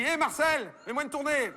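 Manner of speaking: voix nettement plus aiguë de client au comptoir; héler le patron du bar en racontant une blague; client qui interpelle. Acted. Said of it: "il dit". (client de bistrot qui interpelle, voix haut perchée)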